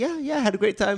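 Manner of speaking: high, thin put-on Melania voice; mock Melania. S1 voicing Melania answering Trump, high and sweet. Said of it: "She's like".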